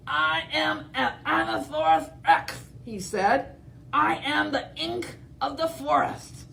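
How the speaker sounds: high, mangled hammed-up boast; garbled dinosaur. T. rex failing to roar, high garbled boast. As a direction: mumble it through feathers.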